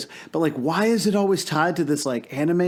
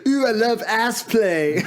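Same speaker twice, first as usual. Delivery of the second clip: slow, higher hammed-up cartoon voice; Shrek; 6 semitones higher, slower than usual. Riffing on the donkey being an ass, slow mock Shrek voice. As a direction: stretch it out in a put-on ogre voice. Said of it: "Yeah, just like".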